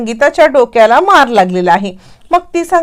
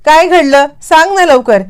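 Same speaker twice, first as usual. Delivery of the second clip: higher and louder, demanding; impatient anger; 6 semitones higher, 3 dB louder than usual. A narrator's reading. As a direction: angrily hurry her to tell. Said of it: "अद्वैत चिडतो". (चिडून, घाईने विचारणारा)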